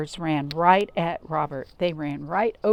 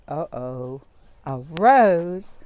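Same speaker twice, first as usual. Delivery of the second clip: uh-oh; 2 semitones lower, 7 dB louder, much slower than usual. Acted. Dread at the rose.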